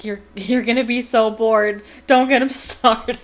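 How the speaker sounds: loud laughing warning; real laugh as she warns Harry about Percy. Real, not acted. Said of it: "because I was like".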